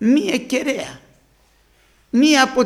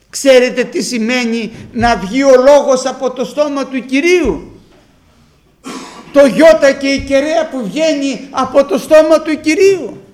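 louder, driving sermon voice; full-force preaching; 3 semitones higher, 5 dB louder than usual. Real, not acted. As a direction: preach it out to the whole church.

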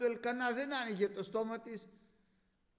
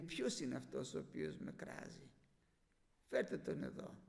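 the Lord softly asking; 6 semitones lower, 7 dB quieter than usual. Acted. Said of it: "Λέει".

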